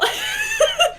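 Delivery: nervous high-pitched giggle; shy giggle before the story. Real, not acted. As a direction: let a bright giggle burst out.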